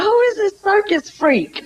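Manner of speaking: high, put-on voice of a stuffy dad; hammed-up disapproving father; mock uptight father. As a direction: sneer it like the outraged dad at the door. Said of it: "And he come and talk about".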